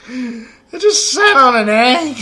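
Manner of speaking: slow, high and loud through laughter; laughing. Real, not acted. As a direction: laugh it out in disbelief. Laughs at himself.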